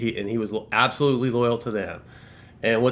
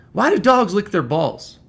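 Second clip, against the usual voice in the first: high, louder quoted question; acted, raised-voice non sequitur; out-of-character question; 9 semitones higher, 5 dB louder than usual. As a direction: pitch it up sharply as an imitated boss's odd question. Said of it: "never heard this guy talk like this".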